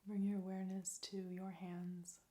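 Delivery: soft, calm meditation voice with long pauses; guided meditation. Real, not acted. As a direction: lead them gently into stillness, leaving space between phrases.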